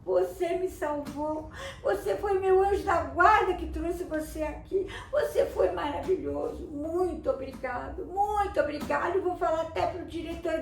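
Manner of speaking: put-on teacher thanking the boy who saved her; grateful teacher. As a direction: pour out gratitude, almost in tears. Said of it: "A professora falou".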